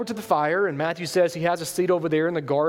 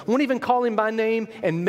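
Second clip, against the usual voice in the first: usual speaking voice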